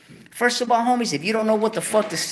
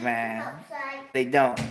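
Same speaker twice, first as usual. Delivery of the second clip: normal talking voice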